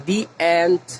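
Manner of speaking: দি অ্যান্ট, with দি said as "dee"; "the" as দি before vowel. Shown as a demonstration. Teacher giving a vowel example, দি অ্যান্ট with "dee". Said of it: "তখন আমরা দি বলবো".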